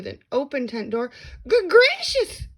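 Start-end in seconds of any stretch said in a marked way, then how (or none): 1.5-2.5 high, loud gasped exclamation. A narrator's reading. Maisie startled to see Horton, high gasping cry. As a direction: gasp it out in shock. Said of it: "gasped Maisie".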